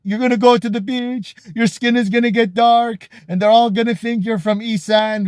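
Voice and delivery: mother warning her daughter about the sun, in a high nag; nagging Thai mother. Retold or imitated. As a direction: scold like a worried mom. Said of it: "She's like".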